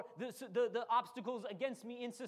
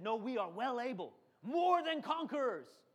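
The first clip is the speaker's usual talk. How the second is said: voice of faith; 4 dB louder than usual. Retold or imitated. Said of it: "That's how faith talks".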